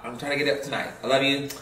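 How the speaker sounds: ordinary delivery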